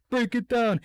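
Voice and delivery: rally everyone to charge; mock battle cry.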